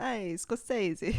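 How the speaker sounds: put-on slow, drawn-out New York accent on "Scorsese". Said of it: "a really thick New York accent".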